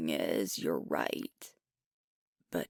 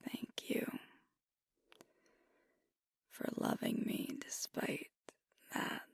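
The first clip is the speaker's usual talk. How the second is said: soft close whisper, words barely voiced; intimate whisper; 6 semitones lower, 5 dB quieter than usual. Alpha confessing self-doubt to his partner, in a whisper. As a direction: confide it in a hush, close to the ear.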